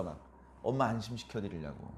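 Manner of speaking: usual speaking voice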